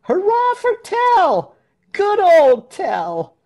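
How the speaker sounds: very high, loud, drawn-out hurrah; cheering crowd shout. A narrator's reading. Crowd hailing Tell after his shot, cheering shout. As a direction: cheer slowly at the top of the voice. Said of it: "shouted the crowd".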